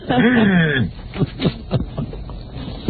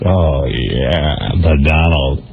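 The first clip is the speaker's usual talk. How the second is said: put-on low, slow Ivana accent; a lightly done Eastern European accent; Czech accent; 8 semitones lower, much slower than usual. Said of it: "listen to that accent".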